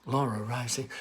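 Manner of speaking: ordinary delivery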